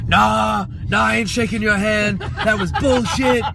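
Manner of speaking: mock the angry wrestler brushing him off, slowing on bullshit; laughing through an imitated angry refusal.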